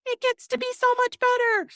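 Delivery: high, loud mocking imitation of a sunny podcast host. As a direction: squeak it out like an overly cheerful guest. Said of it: "she was like".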